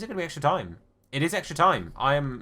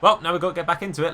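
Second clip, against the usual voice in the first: urgent rally; 5 semitones higher, 7 dB louder, faster than usual. Real, not acted. Conceding a late goal, urging.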